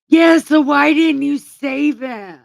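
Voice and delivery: indignant outburst. Real, not acted. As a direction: demand an answer, outraged. Scolding Pilate directly.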